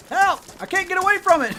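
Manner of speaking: high, loud pretend terror; mock panic. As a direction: cry for help in play fear. Mock panic at square moon.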